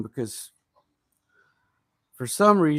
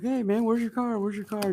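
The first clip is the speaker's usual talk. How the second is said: higher, taunting call; mocking taunt; 7 semitones higher than usual. Chasing the scammer kid, a higher mocking taunt. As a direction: needle the kid who ran off, pitched up. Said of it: "just harass the shit out of him".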